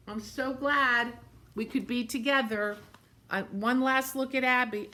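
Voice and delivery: ordinary delivery, nothing marked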